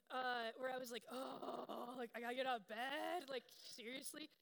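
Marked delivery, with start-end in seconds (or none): 1.1-4.3 loud exasperated groan of a tired mom; huffing at having to get up; annoyed. Retold or imitated. Tired mom annoyed at crying daughter, exasperated. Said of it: "honestly, a bit annoyed".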